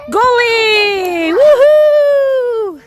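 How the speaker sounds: high, loud, drawn-out celebration; long high sideline whoop; cheering. Real, not acted. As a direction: shout it out like a goal celebration. Cheering a goal in game.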